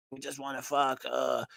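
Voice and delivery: flat robotic monotone. Retold or imitated. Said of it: "completely robotic".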